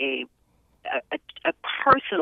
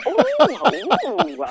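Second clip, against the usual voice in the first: high excited squeals of delight; excited ooh; 10 semitones higher, 5 dB louder than usual. Real, not acted. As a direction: coo with playful excitement. Reacting to the question.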